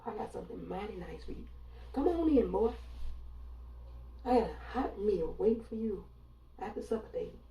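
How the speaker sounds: mock Southern hospitality voice; thick Southern drawl. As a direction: coo it like a Southern hostess luring a guest. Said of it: "They say it with that thick Southern drawl".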